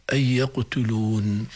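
slow "يقتلون" with long uu into held n; stop on final nun. Shown as a demonstration. Teacher quotes the verse, slow "يقتلون" closing on n. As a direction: draw out the end of "يقتلون". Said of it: "هنا نون مكسورة".

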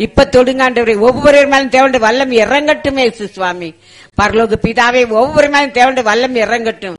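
loud, high, impassioned pleading that turns to command; forceful deliverance prayer. Real, not acted. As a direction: cry out to God at full force, driving out evil spirits. Prayer surges into commanding spirits out.

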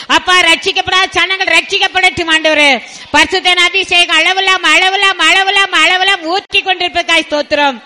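loud, high, impassioned pleading prayer; fervent prayer. Real, not acted. Pastor calls heaven's fire down on the congregation, fervent prayer. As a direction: cry out to God in rolling, repeated appeals.

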